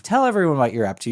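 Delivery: ordinary talk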